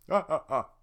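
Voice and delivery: loud spelled-out laugh; Shrek laughing. Acted. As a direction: laugh off the guard. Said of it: "Shrek laughs".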